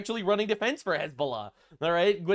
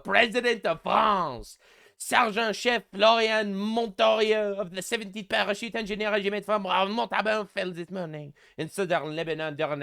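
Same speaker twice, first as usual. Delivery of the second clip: put-on Macron's French accent. Reading Macron's post to viewers, in a mock French accent.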